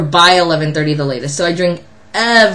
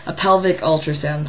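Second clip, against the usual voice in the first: ordinary delivery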